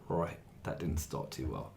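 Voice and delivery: low, soft and slow, mildly deflated; deflated reaction. Retold or imitated. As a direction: murmur it to yourself, a touch crestfallen. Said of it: "I was like".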